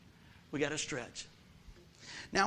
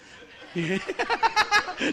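loud laugh; 13 semitones higher, 6 dB louder than usual. Real, not acted. Laughs at own joke.

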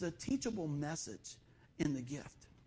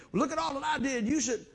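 mock self-righteous; 7 semitones higher, 5 dB louder than usual. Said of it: "you wanna say".

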